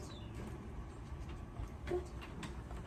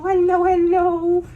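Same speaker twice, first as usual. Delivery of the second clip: higher, louder, slow sing-song hello; excited dog greeting; 10 semitones higher, 8 dB louder than usual. Real, not acted. Trainer greeting her playful dog, excited sing-song. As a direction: greet the bouncing dog with delight.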